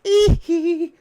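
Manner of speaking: very high, loud falsetto giggle. Retold or imitated. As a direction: squeal the trademark hee hee. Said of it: "my [name] impersonation".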